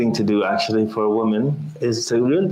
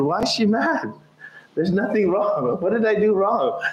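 bewildered husband; 7 semitones higher than usual. Retold or imitated. Confused husband voiced.

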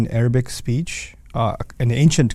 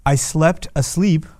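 slow emphatic; 2 semitones higher, slower than usual. Shown as a demonstration. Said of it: "it'll sound something like this in English".

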